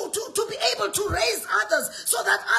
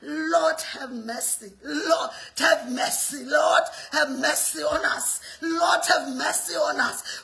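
slow, lower plea for mercy; prayer; 4 semitones lower, slower than usual. Acted. Preacher pleading with the Lord, slow and low. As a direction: pray it slowly and lower.

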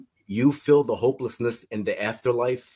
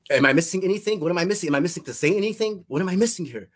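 panicked pleading; 7 semitones higher, faster than usual. Retold or imitated. Panicked questions in the afterlife.

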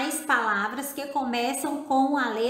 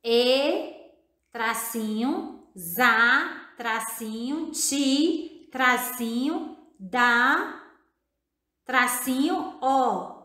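"E, za, ti, da, o" one by one with pauses; syllable separation; much slower than usual. Shown as a demonstration. Teacher separates syllables for children, slow spaced-out dictation. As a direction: dictate each syllable apart, "tracinho" between them. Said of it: "Podemos separar as sílabas também".

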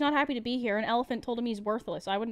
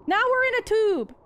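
exasperated yell; 10 semitones higher, 7 dB louder than usual. Real, not acted. Exasperated reaction to the game.

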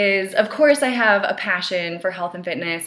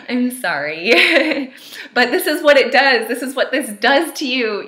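crying-laughing; 5 semitones higher, 6 dB louder than usual. Real, not acted. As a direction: apologize through laughing tears. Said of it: "before I get too emotional".

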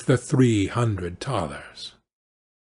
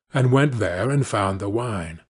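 usual speaking voice

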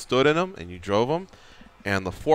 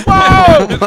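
very high, loud scared cry; panicked yell; 12 dB louder than usual. Retold or imitated. Buddy jolted higher on the forklift, terrified high yell. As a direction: shriek as the lift jumps the wrong way.

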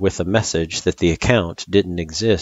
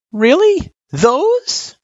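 very high, louder squeaked questions; 6 dB louder, much slower than usual. A narrator's reading. Frisk seeing red-circled test questions, squeaking. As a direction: squeak it in dismayed disbelief. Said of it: "she squeaked".

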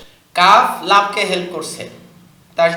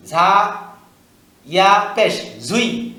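ordinary delivery

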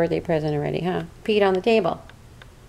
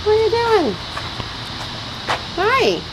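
pet talk; 12 semitones higher, slower than usual. Real, not acted. Owner greeting her outdoor cat Tom Tom in high baby talk. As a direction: stretch it out, way up high, for the kitty.

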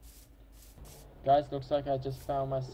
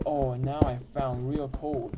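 usual speaking voice

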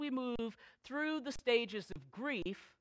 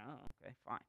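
grudging acceptance; 8 semitones lower, 14 dB quieter, much faster than usual. Retold or imitated. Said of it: "And I was like".

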